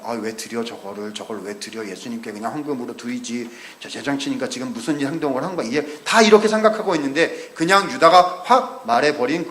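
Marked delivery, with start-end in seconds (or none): 0.0-5.7 muttered under the breath, much softer; people's private grumbling. Retold or imitated. Onlookers grumbling privately about the wasted perfume, muttered softly. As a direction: grumble it quietly to yourself. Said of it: "사람들이 다 생각하고 있었거든요".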